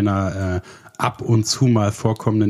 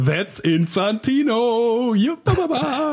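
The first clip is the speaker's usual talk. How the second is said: very high, slow cartoon child voice; Infantino voice; 13 semitones higher, much slower than usual. Goofing around with a friend as a squeaky childish character. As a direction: squeak it out like a silly kid character.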